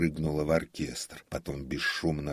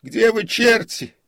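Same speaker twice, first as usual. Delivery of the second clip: very high, loud exasperated yell; angry shout; 17 dB louder than usual. A narrator's reading. Comic curses absent servants in the empty theatre, shouting. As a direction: bellow for the missing servants. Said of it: "крикнул он".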